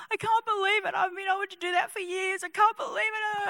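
high, soft, overwhelmed cry; tearful amazement. Acted. Healed pianist marvelling at his hands, acted tearful amazement. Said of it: "And he's crying, he's crying".

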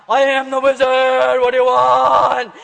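loud, slow booming proclamation; big wizard voice. Retold or imitated. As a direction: thunder it out, every word stretched. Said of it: "there's this big voice that comes from behind".

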